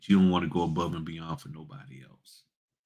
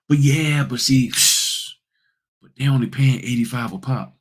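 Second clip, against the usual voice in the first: mock complaint; 5 semitones higher, 4 dB louder than usual. Acts out a complaining notary.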